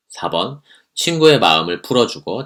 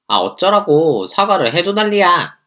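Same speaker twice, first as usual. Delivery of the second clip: high, loud exasperated comeback; fed-up snapping; 9 semitones higher, 4 dB louder than usual. Acted. Annoyed girlfriend snaps at the boyfriend. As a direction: snap back in frustration.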